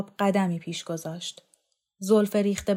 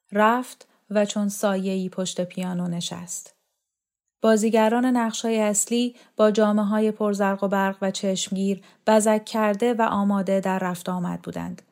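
usual speaking voice

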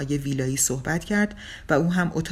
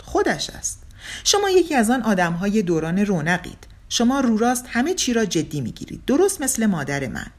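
excited teasing; 7 semitones higher, 5 dB louder than usual. A narrator's reading. Young man teasing Sachi.

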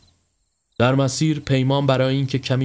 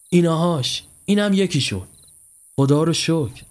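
lightly acted glad relief, pitched up; mildly delighted, higher voice; happy; 6 semitones higher than usual. Spotting a villager on the empty road, happy and relieved. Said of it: "مهراب با خوشحالی گفت".

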